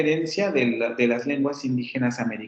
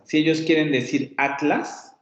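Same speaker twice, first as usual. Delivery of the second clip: ordinary talk, nothing marked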